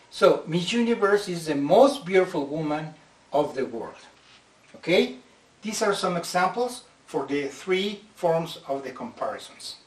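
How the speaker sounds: normal talking voice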